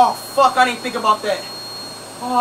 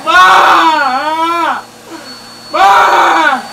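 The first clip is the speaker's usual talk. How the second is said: shouting for mom; much slower than usual. Real, not acted. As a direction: yell for Ma from across the house. Calling for his mother, stuck.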